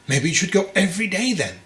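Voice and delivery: high, pointed retort from the mother; mother snapping back; sharp mother. A narrator's reading. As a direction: needle him as the mother.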